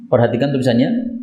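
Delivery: ordinary delivery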